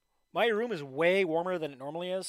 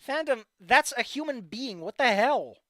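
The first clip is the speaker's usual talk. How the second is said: outraged disbelief; 6 semitones higher, 6 dB louder than usual. Real, not acted. Loud outraged reaction.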